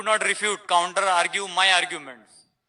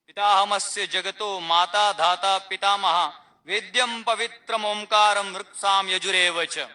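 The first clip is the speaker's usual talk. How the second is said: Sanskrit verse recitation. Retold or imitated. A seeker reciting Gita verse to the speaker in recitation tone. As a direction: recite the shloka in a measured, devotional cadence.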